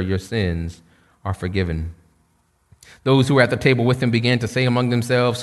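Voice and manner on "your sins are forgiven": soft, low and gentle; tender Jesus. Retold or imitated. Jesus gently tells the sinful woman, soft and low. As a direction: lay the words down softly, with mercy.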